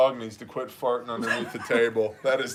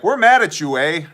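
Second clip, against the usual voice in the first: raised, loud Canadian complaint; mock-angry Canadian; 4 semitones higher, 8 dB louder than usual. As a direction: put on an indignant Canadian emailer. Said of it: "bunch of angry emails".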